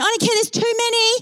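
high, insistent mock fussing; bossy protest. Embarrassing rider to people crowding in, high bossy protest. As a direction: shoo them off in a high voice. Embarrassing person refusing others entry.

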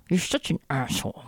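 soft, clenched through gritted teeth. Pretend. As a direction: spit it out with jaw locked. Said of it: "I say through gritted teeth".

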